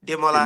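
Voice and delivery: Demola with a Day sound first, La as La; Yoruba pronunciation Demola. Shown as a demonstration. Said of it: "the D is pronounced as Day".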